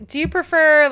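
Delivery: ordinary talk, nothing marked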